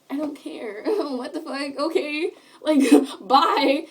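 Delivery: dismissive laugh through the words. Real, not acted. Scene: laughing off the bullies' taunts to her viewers, amused. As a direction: chuckle while brushing them off.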